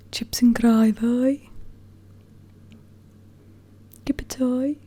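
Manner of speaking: slow whispered Brummie accent, mocking himself. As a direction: whisper it in a thick Birmingham accent. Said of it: "it sounded so ridiculous".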